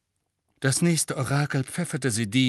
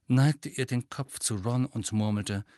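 usual speaking voice

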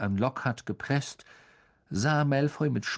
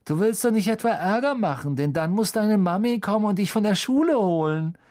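high-pitched mocking taunt; sneering, sing-song scorn; jeering; 9 semitones higher, 5 dB louder than usual. A narrator's reading. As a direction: tease him like a school bully. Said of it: "höhnte Malfoy".

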